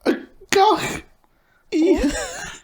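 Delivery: high put-on schoolgirl voice; acting as Mary. Mary greets Sean in an oral exam skit, high voice. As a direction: play Mary greeting Sean in stilted Irish. Acted Irish exam dialogue.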